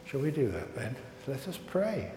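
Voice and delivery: ordinary talk, nothing marked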